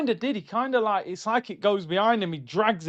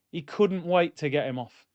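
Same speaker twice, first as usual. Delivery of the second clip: ordinary talk, nothing marked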